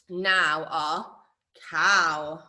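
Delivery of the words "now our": usual speaking voice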